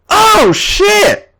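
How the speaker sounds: high, loud startled cry; AJ's shocked high-pitched cry; startled exclamation. A narrator's reading. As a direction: blurt it out, caught off guard. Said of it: "A J exclaimed".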